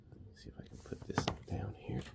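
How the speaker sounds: breathy whisper, quick and half to himself; whispered aside. Real, not acted. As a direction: drop to a hushed whisper while juggling the knives. Aside while handling two knives.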